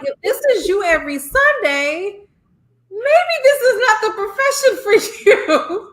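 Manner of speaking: high, loud mock reply breaking into laughter; laughing retort. Telling unhappy teachers to quit, laughing loudly. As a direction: laugh through the comeback.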